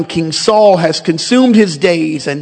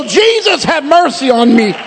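crying out louder; 6 semitones higher than usual. Acted. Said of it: "he cried out the more a great deal".

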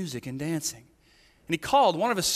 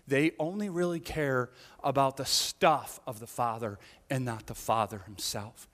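usual speaking voice

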